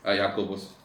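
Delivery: normal talking voice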